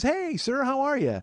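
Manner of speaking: high bright chirpy greeting; cheerful clerk. Retold or imitated. Smiley desk clerk greeting a guest cheerfully. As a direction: chirp a sunny customer-service hello. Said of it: "she's all smiley and cheerful".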